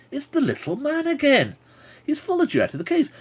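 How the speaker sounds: very high, squeaky little figure; little man's voice. Acted. Little man complains about being followed out, high piping voice. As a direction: pipe it up high like a tiny man.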